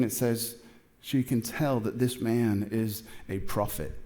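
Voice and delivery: normal talking voice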